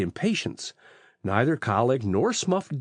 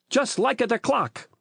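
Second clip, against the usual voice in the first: put-on high, excited Italian-accented voice; Italian fruit seller; 9 semitones higher than usual. A narrator's reading. Rocco confirms the ticking to the chief, excited Italian voice.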